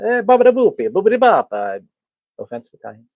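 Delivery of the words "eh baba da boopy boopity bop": loud, high Italian gibberish; hammed-up cartoon Italian accent; mock Italian. Greeting an Italian who walked in, mock Italian accent. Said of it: "had somebody walk in here that was Italian".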